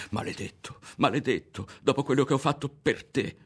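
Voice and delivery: angry cry, building in pitch and volume; bitter scolding that climbs to a shout; shouted curse. A narrator's reading. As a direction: curse him, rising to a yell. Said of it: "la udì gridare ancora".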